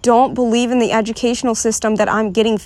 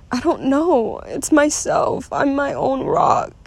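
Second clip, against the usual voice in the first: real crying; 3 semitones higher than usual. Real, not acted. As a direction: struggle to speak through tears. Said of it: "I'm starting to cry".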